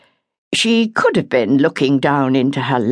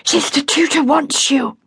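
higher, breathless and hurried; urgent; 11 semitones higher than usual. A narrator's reading. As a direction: blurt it out, out of breath from running after her. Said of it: "she said urgently".

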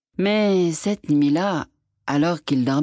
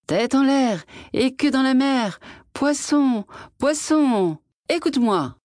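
higher sing-song call across the water; 9 semitones higher than usual. A narrator's reading. Fisherman calling the magic fish from shore, sing-song call. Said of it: "et il cria".

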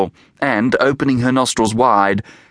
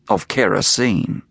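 ordinary delivery

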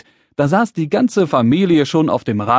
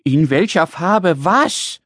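slow, high, incredulous question; exasperated little man; 4 semitones higher, slower than usual. Acted. Little man desperate to know the present, exasperated. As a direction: drag it out to a rising WAS.

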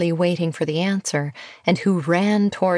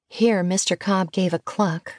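usual speaking voice

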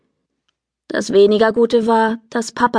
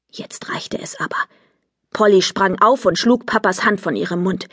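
plain reading voice

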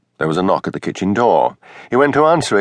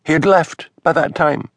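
stiffly, in the higher wife's voice; stiff minister's wife; 8 semitones higher than usual. A narrator's reading. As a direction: clip it stiffly as the offended wife. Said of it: "said Missus Wellington stiffly".

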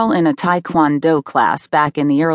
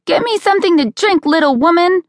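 put-on very high, bossy child's demand; barking little boy; 13 semitones higher than usual. A narrator's reading. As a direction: order mom around like a tiny tyrant. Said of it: "punched me in the back and barked".

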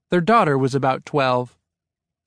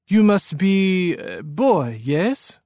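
put-on higher-pitched French-accented man; 5 semitones higher, much slower than usual. A narrator's reading. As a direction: greet a newcomer in a French accent. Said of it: "in a French-sounding accent".

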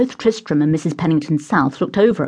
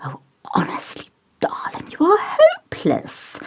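whispered aside from a mother to her son; exasperated whisper; 12 semitones higher than usual. Acted. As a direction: hiss a fond rebuke under her breath.

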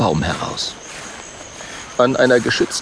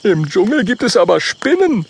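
put-on higher, louder young dragon voice; Oskar's voice; 11 semitones higher, 4 dB louder than usual. Oskar warns his friends about spiders, higher young voice. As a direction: warn with a higher voice. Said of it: "sagt Oskar".

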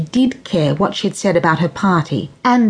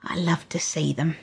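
lightly voiced grandmother, hushed at the end; wistful granny fading to a whisper; 3 semitones higher than usual. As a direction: confide the loss, trailing away. Granny confiding about fairies.

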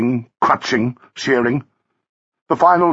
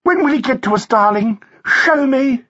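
high, gushing society woman; pitched-up, impatient darling-ing; imperious wife; 13 semitones higher than usual. A narrator's reading. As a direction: demand it gaily in a high voice.

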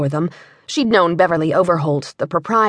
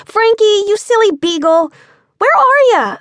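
high and louder, calling down the street; 12 semitones higher, 6 dB louder than usual. A narrator's reading. Girl hunting for her beagle, high loud calling. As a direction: call the dog home, teasing. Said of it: "Lena called out".

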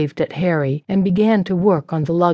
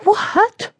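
shrieking high, outraged; shocked and offended; outraged yell. A narrator's reading. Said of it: "Sarah yelled".

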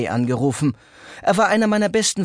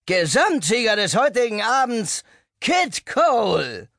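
announcer call; 7 semitones higher than usual. A narrator's reading. Announcing the winner.

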